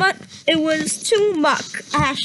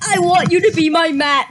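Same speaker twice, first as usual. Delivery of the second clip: loud, fast hammed-up declaration; mock dramatic suitor; 6 dB louder, faster than usual. Reader mocking the fanfic line, hammed up and loud. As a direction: overdo the cat's romantic line, louder.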